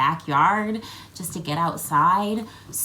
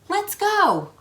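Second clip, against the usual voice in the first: cheerful call; 13 semitones higher, 5 dB louder than usual. Acted. Mother cheerfully starts the hike.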